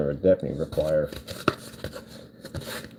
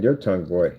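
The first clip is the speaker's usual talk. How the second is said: ordinary delivery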